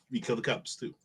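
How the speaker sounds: ordinary delivery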